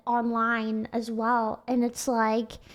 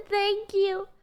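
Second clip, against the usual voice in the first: polite thanks; 6 semitones higher than usual. Retold or imitated. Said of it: "So I just say".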